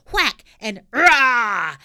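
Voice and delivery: loud slow cry of whack and rah; battle cry. Acted. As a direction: roar the blow out. Fight sound effect.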